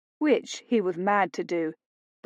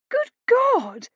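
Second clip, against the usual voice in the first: much higher, louder outcry; shout; 5 dB louder than usual. A narrator's reading. Phoebe at the slap, loud high shout. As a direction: cry out in shock. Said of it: "Phoebe shouted".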